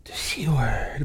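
gasp, then hushed mock horror; mock shocked. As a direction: imitate scandalized listeners gasping at a rude word. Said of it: "when you say that, they're like".

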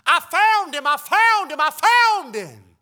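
put-on high, loud child yelling it over and over; a little boy running home thrilled; excited child shouting. Preacher voices Titus running home to announce his find, shouting child. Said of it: "he runs to the house".

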